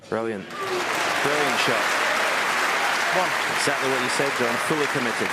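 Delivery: loud, thrilled praise; excited shout. Real, not acted. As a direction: burst out over a brilliant shot. Great shot, commentator erupts.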